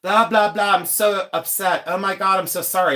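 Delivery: high-pitched, hammed-up horror; mock mortified waitress. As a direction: imitate a gushing, horrified apology. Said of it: "she was, like, mortified".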